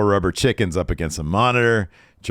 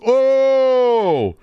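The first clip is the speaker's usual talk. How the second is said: high mock crowd "Oh!", louder; 13 semitones higher, 5 dB louder than usual. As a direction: imitate a shocked crowd going "Oh!". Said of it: "And the crowd goes".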